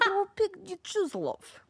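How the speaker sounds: lightly put-on Eastern European accent, slow; mock Eastern European accent. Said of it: "what is this accent?".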